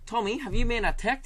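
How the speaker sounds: high mock Kiwi radio host; New Zealand accent. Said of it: "I've got it now".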